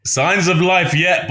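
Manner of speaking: usual speaking voice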